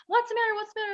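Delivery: high, loud, worried voice of passers-by; concerned strangers. Retold or imitated. Strangers in Times Square asking the sobbing girl, alarmed. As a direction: call out to her with sudden worry. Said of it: "Everyone's like".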